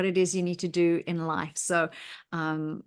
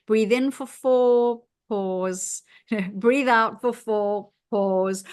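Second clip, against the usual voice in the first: slow, drawn-out guided breathing count; meditation instructions; 2 semitones higher, 4 dB louder, slower than usual. Retold or imitated. Host quoting meditation apps, slow calm counting voice. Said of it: "many of the meditations will say".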